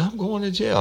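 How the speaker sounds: falsetto panicked woman pleading; high mock wail. Detective imitates the suspect pleading innocence, falsetto whine. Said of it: "She said".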